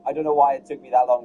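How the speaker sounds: ordinary delivery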